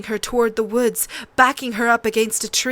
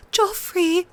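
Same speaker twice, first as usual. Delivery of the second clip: sobbing; 9 semitones higher than usual. A narrator's reading. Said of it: "she sobbed".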